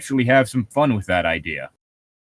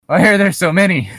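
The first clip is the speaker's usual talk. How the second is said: exasperated outburst; 6 semitones higher, 5 dB louder, faster than usual. Real, not acted. Frustrated at long list.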